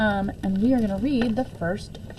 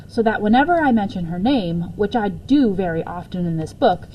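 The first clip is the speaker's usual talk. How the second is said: ordinary delivery, nothing marked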